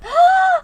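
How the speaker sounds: loud, high sudden gasp; a big high-pitched startled gasp. Real, not acted. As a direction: gasp sharply in shock. Shocked reaction.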